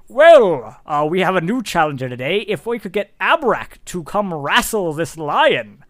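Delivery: showman opening on a big loud "Well"; ring announcer. Acted. Man calls Abrak up to wrestle the lion, announcing. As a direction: stretch the key words like a ringside host. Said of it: "you hear the man go".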